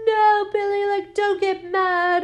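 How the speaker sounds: high, put-on pleading voice; Mary Shaw. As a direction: plead with the puppet, pitched high. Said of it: "And Mary Shaw's like".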